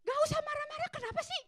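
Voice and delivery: high, sharp snapping retort; a mother losing patience with her son, high and sharp; irritated mother. Retold or imitated. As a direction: snap back at the sulking kid. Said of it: "pengen bilang begini nih".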